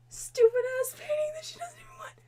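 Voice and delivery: high, strained whisper of disbelief; exasperated whisper-shout. Real, not acted. Ranting to viewers about the pointless painting, exasperated whisper. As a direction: hiss it out, fed up.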